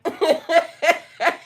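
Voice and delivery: a burst of high, loud laughter. Real, not acted. To her live viewers, laughing loudly at herself. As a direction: cackle out loud at your own line.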